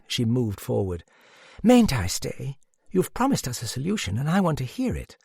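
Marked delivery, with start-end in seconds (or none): 1.6-5.2 put-on high, quick girlish plea; eager high-pitched girl's voice; pleading girl. A narrator's reading. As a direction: beg to stay in a raised, hurried voice. Narrator voices the girl pleading to stay.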